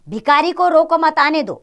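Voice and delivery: "भिखारी को रोको मत आने दो" run together without a break; no pause. Shown as a demonstration. Master ordering a servant, "भिखारी को रोको मत आने दो" with no pause. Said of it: "बिना किसी शब्द पर रुके यह कहे".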